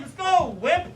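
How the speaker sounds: loud, high goading jeer; acted drill-style heckle; name-calling taunt. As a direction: taunt him into acting. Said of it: "he starts calling me names".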